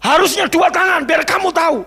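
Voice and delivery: loud, high shouted "serves you right"; mock gloating scolding. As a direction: act out a furious parent rubbing it in. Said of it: "Apa saya syukurin?".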